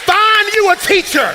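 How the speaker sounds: loud, high, whooping preacher's cry; preaching at full force. Acted. Pastor whooping at the congregation, loud and high. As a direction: preach it out over the organ.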